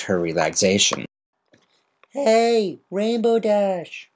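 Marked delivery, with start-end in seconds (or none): 2.1-4.1 high, drawn-out call across the sky. Acted. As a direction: shout her name to get her attention. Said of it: "shouted a familiar voice".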